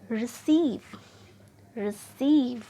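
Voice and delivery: Receive with CEIVE stretched and loud; stress on second syllable. Shown as a demonstration. Teacher stretching the second syllable of receive. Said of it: "second syllable को खींचकर बोला".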